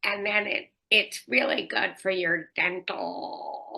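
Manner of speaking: mock an affected ad spokesperson, stretching the ending; exaggerated drawling spokesperson imitation; irritating commercial spokesperson. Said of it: "a spokesperson for your commercials who I can't listen to".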